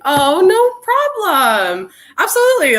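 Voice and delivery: high, eager reply; excited. Real, not acted. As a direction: brush off thanks eagerly. Thanked for hosting.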